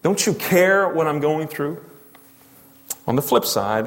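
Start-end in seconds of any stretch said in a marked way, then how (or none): 0.0-1.8 high, accusing plea to God; raised voice, aggrieved questioning; indignant protest. Acted. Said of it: "had that rise up within you".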